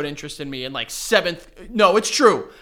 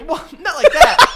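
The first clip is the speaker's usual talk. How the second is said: laughing; 14 semitones higher, 9 dB louder than usual. Real, not acted. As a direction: protest through high laughter. Laughing protest.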